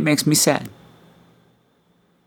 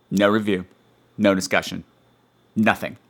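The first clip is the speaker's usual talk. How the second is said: ordinary talk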